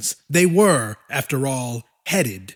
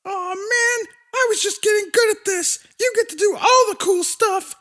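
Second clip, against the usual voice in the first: much higher, fast whiny little monster; sulky high monster voice. A narrator's reading. Willix whining to her brother, high and fast. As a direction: whine like a kid denied the fun.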